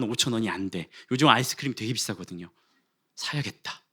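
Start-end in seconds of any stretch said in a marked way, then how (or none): 3.1-3.8 a secret little resolve, breathed out; whispered thought. Retold or imitated. Pastor voicing his own thought in the store, whispered. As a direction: whisper 사야겠다 to yourself, decided. Inner decision to buy ice cream.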